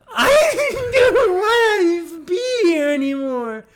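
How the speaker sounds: mock crying. Mock ad lament.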